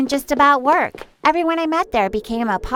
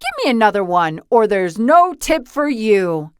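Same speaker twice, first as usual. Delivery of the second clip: grumpy complaint. Acted. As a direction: grumble and demand like a cheapskate. Stingy customer complains.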